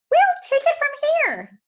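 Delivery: high, loud chirpy bird voice; birds' voice. Acted. Park birds telling Harriet, high and chirpy. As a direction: sing it out like the birds. Birds speak in read story.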